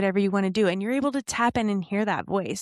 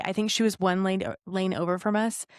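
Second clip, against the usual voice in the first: normal talking voice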